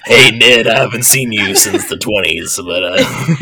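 low, rough ghoul voice; Beetlejuice. Pretend. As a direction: sneer it like a cheeky ghoul. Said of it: "I was definitely going for Beetlejuice".

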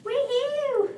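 high cheer. Real, not acted. New Year cheer on helium.

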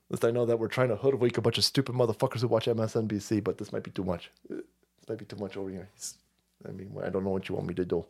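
low, soft, hurried doubts; uneasy criminal. Acted. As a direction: mumble it low as a reluctant stooge.

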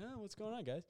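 high-pitched, casual mock hello; imitated oblivious greeting. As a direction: play a friend unaware of the tension. Oblivious friend amid horrified riders.

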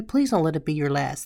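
ordinary talk, nothing marked

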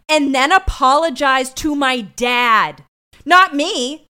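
loud, slow, indignant outburst; mad all over again. Real, not acted. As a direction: hammer each word out in outrage. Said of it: "I'm mad all over again".

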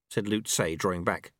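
plain reading voice

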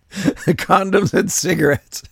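slow, high, laughing disbelief; cracking up in disbelief. Real, not acted. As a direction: say it through real laughter. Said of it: "tickled my funny bone".

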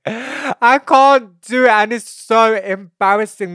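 very high, loud, drawn-out whine; exasperated wail. Real, not acted. Host despairing to listeners about her voice, wailing.

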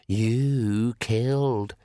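very slow, eerie accusing voice; shadow voice. Acted. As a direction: drag out the accusation from the dark.

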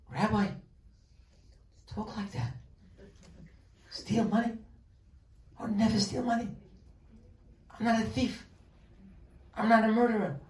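indignant congregant. Retold or imitated. Congregant answering the rabbi, high and offended. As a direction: play the shocked congregant refusing the idea.

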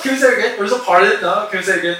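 loud, high, flustered asking to repeat; baffled repeat requests. Retold or imitated. Retelling asking the customer to repeat, flustered and loud. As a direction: keep begging him to repeat, bewildered and laughing.

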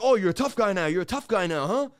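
loud imitated cop jeer; aggressive taunt. Cop mocking the suspect he is pinning, aggressive taunt.

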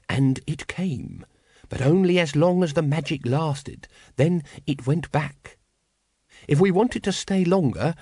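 lightly voiced master, slow deliberate phrases; cult leader. A narrator's reading. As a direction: explain it to followers with measured authority. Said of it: "he said".

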